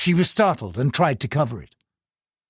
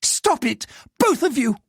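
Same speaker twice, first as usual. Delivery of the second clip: higher shrill-edged scolding; screech; 10 semitones higher than usual. Acted. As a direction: scream at the servants to stop. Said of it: "Diane Chen screeched at the armours".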